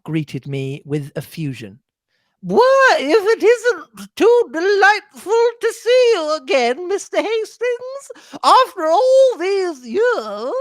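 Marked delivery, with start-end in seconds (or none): 2.4-10.5 high, slow, effusive society lady; gushing old lady. Acted. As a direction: gush with delight at an old friend. Mrs Inglethorpe effusive greeting.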